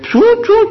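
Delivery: high squeaky choo choo like a scanner alarm; playful high beeping noise; imitated beeping. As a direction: mimic the security beeper going off. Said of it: "they started making noise".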